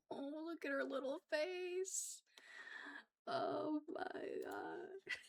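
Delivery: soft, slow cooing; overwhelmed by cuteness. Real, not acted. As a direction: melt over a cute pet. Cooing at her pet.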